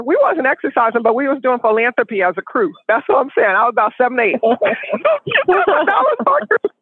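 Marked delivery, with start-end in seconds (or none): 4.4-6.7 laughing. Real, not acted. Laughs while saying it.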